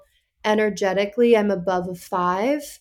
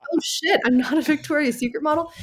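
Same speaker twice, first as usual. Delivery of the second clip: deflated realization; 7 semitones higher, faster than usual. Retold or imitated. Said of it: "I'm like".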